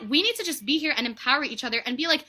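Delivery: normal talking voice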